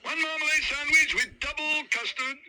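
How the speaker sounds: loud relayed call. Acted. Kitchen hand shouts the order onward.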